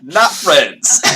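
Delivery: loud, high call back; shouted denial. Acted. Jim rejects Belle's friendly farewell, shouting back. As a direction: shout the rebuff across the room.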